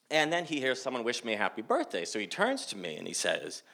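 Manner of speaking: ordinary delivery, nothing marked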